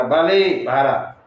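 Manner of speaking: loud, drawn-out challenge. Acted. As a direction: call the rival out, slow and loud. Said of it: "Sugriva ललकारा". (ऊँची आवाज़ में ललकार)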